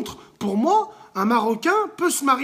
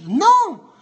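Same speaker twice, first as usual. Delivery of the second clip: sharp, loud, higher no; loud emphatic no; 8 semitones higher, 7 dB louder than usual. Real, not acted. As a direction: shout the refusal. Emphatic refusal in debate.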